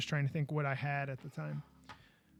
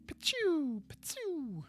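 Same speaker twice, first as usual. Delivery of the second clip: high, falling "pachoo" blaster shots; mouth-made laser zaps, high then falling; laser blaster noise; 12 semitones higher, much slower than usual. Pretend. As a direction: imitate sci-fi gunfire, pitch dropping. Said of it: "no, they go pew".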